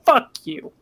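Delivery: playful defiant comeback; retold mock outburst. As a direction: snap back in mock outrage. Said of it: "And I'm like".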